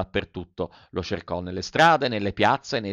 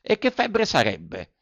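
higher, slow, anxious puppet voice; Pinocchio, worried puppet; 6 semitones higher, slower than usual. A narrator's reading. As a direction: ask it fearing the answer. (voce alta e preoccupata del burattino)

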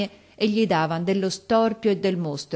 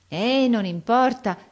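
higher, drawn-out gentle dismissal; cheerful yet wistful; 6 semitones higher, 4 dB louder, slower than usual. A narrator's reading. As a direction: brush it off with a wistful smile. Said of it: "col suo sorriso allegro, insieme e malinconico".

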